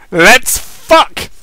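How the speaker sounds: hammed-up eager shout; eager outburst. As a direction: burst out with it, all impatience. Eager call to get going.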